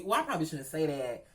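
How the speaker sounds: ordinary talk, nothing marked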